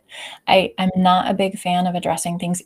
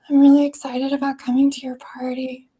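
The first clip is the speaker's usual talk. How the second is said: forced cheer, voice higher and louder; fake excitement; 7 semitones higher, 5 dB louder than usual. Shown as a demonstration. Introvert accepting a party invite, forced excitement. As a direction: pretend to be thrilled but don't mean it. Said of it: "my voice is telling you I'm not excited".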